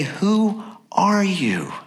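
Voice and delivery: slow, breathy whisper of a wary questioner. Acted. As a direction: hush it out slowly, like a suspicious envoy. Priests questioning John.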